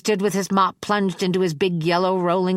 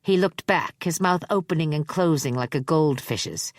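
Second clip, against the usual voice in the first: plain reading voice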